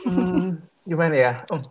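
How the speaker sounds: drawn-out pondering hmm; thinking hmm. Real, not acted. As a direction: hum while searching for an answer. Pondering a hard question.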